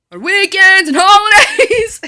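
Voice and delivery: high, slow, cracking into laughter; can't keep a straight face; giggling through the punchline; laughing answer. Real, not acted. Silly answer, breaks laughing.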